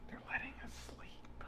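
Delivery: unvoiced whisper. Real, not acted. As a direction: whisper it under your breath. Whispered aside.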